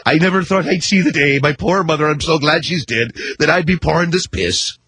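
a touch of mock tearful grief; mock crying pub owner. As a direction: imitate a heartbroken publican, hammed-up. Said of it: "they're crying, going".

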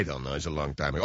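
put-on low, soft old man voice; old man mumbling. Old Nazi dodges the question, low and soft.

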